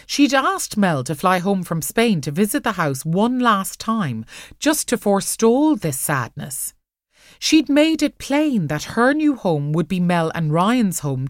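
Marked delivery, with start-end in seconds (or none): none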